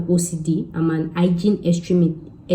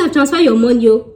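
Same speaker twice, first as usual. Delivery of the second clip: higher and louder, gleeful; triumphant announcement; 5 semitones higher, 6 dB louder than usual. Retold or imitated. Tenant announcing she paid the landlady, gleeful. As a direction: crow that the deal is done. Triumphant after paying rent.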